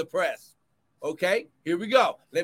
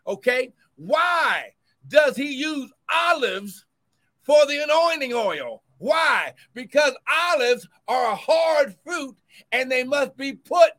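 loud, rising sermon cadence; fervent preacher building; preaching at full force; 4 semitones higher, 4 dB louder than usual. Acted. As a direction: drive the point home to the church. Preaching on the press.

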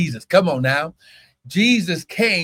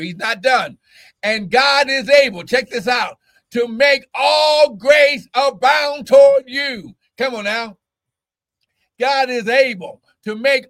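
preaching at full force; 6 semitones higher than usual. Acted. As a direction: drive it home like a fired-up preacher.

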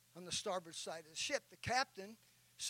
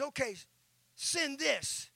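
higher, louder angry order; furious captain; 8 semitones higher, 9 dB louder, slower than usual. Retold or imitated. Captain ordering the lookout to signal back, angry and loud. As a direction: bark the order like an enraged captain. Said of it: "He is furious".

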